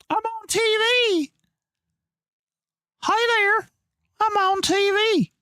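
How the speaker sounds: very high, put-on little voice; squeaky falsetto. Playful squeal at appearing on screen.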